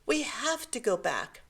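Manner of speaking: put-on high-pitched, urgent child's plea; distressed cry. A narrator's reading. Seven-year-old begging mother to go back for the toy, distressed cry. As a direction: plead like an upset little boy. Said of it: "he cried".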